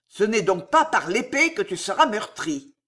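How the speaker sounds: ordinary delivery, nothing marked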